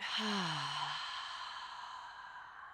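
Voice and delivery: long open-mouthed sigh out; audible sigh. Shown as a demonstration. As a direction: let a deep breath go on a sigh. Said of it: "exhale to sigh it out".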